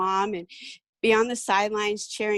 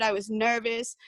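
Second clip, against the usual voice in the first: ordinary delivery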